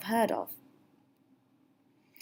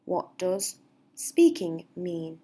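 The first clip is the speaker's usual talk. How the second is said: slow clear model; 2 semitones lower, slower than usual. Shown as a demonstration.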